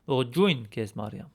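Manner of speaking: Ողջույն քեզ Մարիամ said fast; Eastern Armenian. Shown as a demonstration. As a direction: model the Eastern phrase. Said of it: "or in Eastern Armenian".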